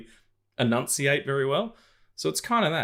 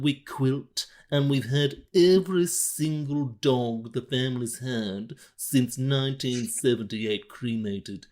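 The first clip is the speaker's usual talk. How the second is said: slow, chin-jutted posh British drawl with a Brando mumble; posh Marlon Brando; 3 semitones higher, slower than usual. Pretend. Mocking the posh ballet crowd in a slow Brando-posh drawl. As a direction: speak like you have money in your mouth, slowly. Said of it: "between Marlon Brando and, like, a really posh British person".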